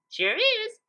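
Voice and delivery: high, chirpy insistence; breezy and sure; Pinkie voice. Acted. Pinkie cheerfully insists to Twilight.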